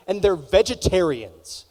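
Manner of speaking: ordinary talk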